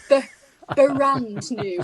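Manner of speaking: slow, exaggerated "brand new", B pulled apart from the R; over-enunciated correction. Shown as a demonstration. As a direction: break off the B, then "buh-rand new". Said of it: "I'm not pronouncing it properly".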